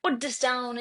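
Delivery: a hint of a stern mother; bad cop scolding. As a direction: tell the boy off like a strict mom. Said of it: "I'm the bad cop in the house".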